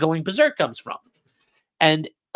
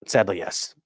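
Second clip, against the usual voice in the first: "Sadly, yes" repeated much softer and lower; soft mock-sad echo; 6 semitones lower, 8 dB quieter than usual. Shown as a demonstration. Streamer answering a viewer, echoing himself in a soft murmur. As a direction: let the second "Sadly, yes" sink into a murmur. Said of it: "Are you telling me ... is cringe?".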